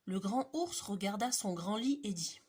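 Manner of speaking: ordinary delivery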